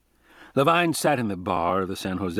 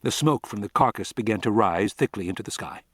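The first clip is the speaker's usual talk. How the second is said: ordinary delivery, nothing marked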